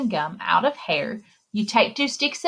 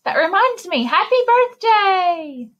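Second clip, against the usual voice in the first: much higher, louder, slow happy birthday; cheerful birthday wish; 9 semitones higher, 3 dB louder than usual. Acted. Storyteller suddenly wishing the child happy birthday. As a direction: burst out with a bright birthday cheer.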